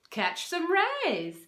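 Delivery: usual speaking voice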